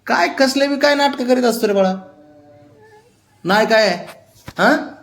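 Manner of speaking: teasing pet scold. Real, not acted. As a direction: tease the pet for its drama, then order it up.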